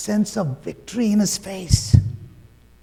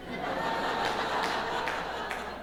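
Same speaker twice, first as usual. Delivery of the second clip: soft breathy laugh; 10 dB quieter than usual. Real, not acted. Laugh after punchline.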